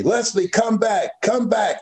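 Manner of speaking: high-pitched calling out without much extra volume; imitated yelling. Mother yelling after her runaway son, imitated urgent call. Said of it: "she was yelling at me".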